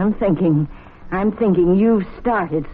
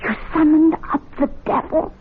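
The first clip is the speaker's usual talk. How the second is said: shocked disbelief; 9 semitones higher than usual. Acted. Wife alarmed at devil summoning.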